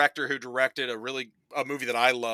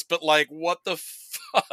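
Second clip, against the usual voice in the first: ordinary talk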